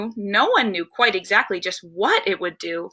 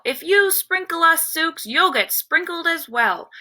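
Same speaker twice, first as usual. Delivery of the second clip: raised, high-pitched storybook yell; mock yell; 7 semitones higher than usual. A narrator's reading. Rival warning the narrator mid-flight, mild high-pitched yell. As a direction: call it out high as a threat, without full volume. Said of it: "I heard a voice yell".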